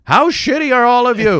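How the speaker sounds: high, very loud outburst; mock incredulous shout. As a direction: bellow your disbelief way up high. The outburst he held back.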